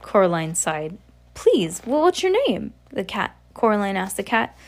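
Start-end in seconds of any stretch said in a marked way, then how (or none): 1.3-2.7 put-on eager girl. A narrator's reading. Coraline asks the cat's name.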